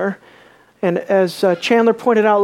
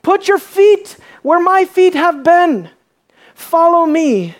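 high loud slow call across the shore; shouted call of Jesus to fishermen; shouting; 8 semitones higher, 4 dB louder, slower than usual. Acted. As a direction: rabbi calling out along the sea.

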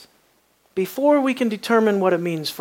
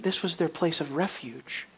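ordinary talk

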